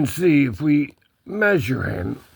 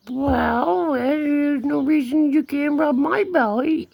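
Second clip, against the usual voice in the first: high put-on kitty talk; cat voice; 12 semitones higher than usual. Cat arguing for a belly rub, high cat voice. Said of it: "She says".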